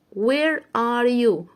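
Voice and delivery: "Where are you?" drawn out word by word; very slow read. Shown as a demonstration. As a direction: read it slowly for the class. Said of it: "يبقى where".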